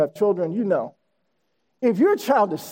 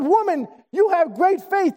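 high-pitched exclamation of amazement; shocked; 8 semitones higher than usual. Retold or imitated. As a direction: imitate astonished praise, pitched well up. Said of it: "Jesus was so shocked by that".

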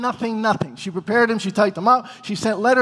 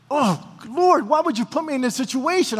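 higher-pitched mock lament to God; anguished plea; 5 semitones higher than usual. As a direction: plead with the Lord, asking why.

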